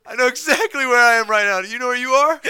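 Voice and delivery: put-on high, loud Indian accent retort. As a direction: snap back in an Indian accent. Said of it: "my attempt at, like, an Indian or Pakistani accent".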